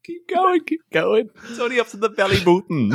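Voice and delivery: high, fast, teasing through laughter; laughing along as narrator; giggling tease. Real, not acted.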